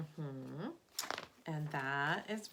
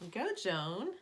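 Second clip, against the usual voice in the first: ordinary delivery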